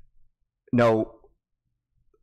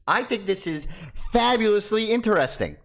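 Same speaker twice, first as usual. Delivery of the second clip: mock enthusiasm; 8 semitones higher than usual. Mock enthusiasm, high pitch.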